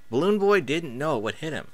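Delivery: ordinary delivery, nothing marked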